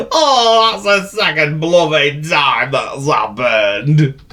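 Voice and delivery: high, loud, slow lament; wailing goat. Acted. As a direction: moan about the second mishap. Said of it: "he wailed".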